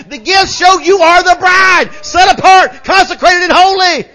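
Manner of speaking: high, loud preacher's shout building in intensity; full-force preaching. Real, not acted. Preacher urging the church on the gifts, full-force preaching. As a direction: preach it out at the top of your voice.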